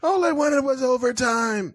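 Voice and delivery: high mock wail; hammed-up dying henchman. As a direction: moan it like a doomed goon.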